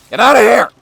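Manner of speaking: loud, high shout to shoo off; whisper yelling. Real, not acted. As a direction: yell them out of the room. Shouting intruder away.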